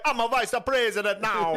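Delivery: high put-on Jamaican accent; proud Jamaican accent voice. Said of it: "do Jamaican accent".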